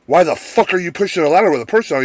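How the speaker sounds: fast, high mock-outraged retort; retold heated comeback; angry retort. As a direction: rattle off an incredulous telling-off. Acted quarrel, answering back.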